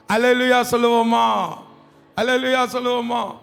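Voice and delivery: loud, driving proclamation; fervent preaching. Real, not acted. Preaches at full force.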